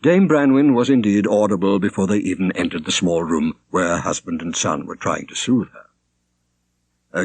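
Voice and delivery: ordinary delivery, nothing marked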